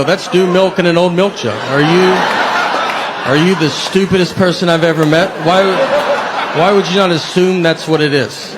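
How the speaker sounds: loud, sarcastic scolding rant; hammed-up outrage at the guest; mock exasperated rant. Telling a guest about the milk with mock exasperation. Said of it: "And I have to go".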